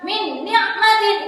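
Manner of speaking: no held hum between "Min" and "ni'matin"; wrong clear reading. Shown as a demonstration. Teacher shows the wrong reading like idhar, hum left out. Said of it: "Tidak boleh dibaca jelas seperti idhar".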